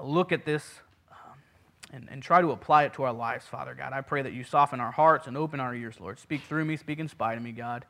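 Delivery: ordinary delivery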